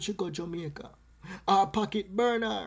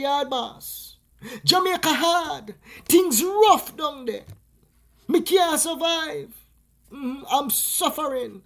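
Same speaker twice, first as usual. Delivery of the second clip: high, loud, slow pleading complaint; mock lament; 8 semitones higher, 6 dB louder, slower than usual. Jamaicans telling Yard Boss why they left, mock lament. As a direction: whine it like a hard-luck story.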